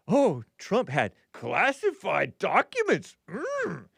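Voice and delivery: lightly voiced Yoda, slow and strained; a hint of Yoda while mocking. Host mocking Trump to chat, light Yoda voice. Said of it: "when you sounded like Yoda".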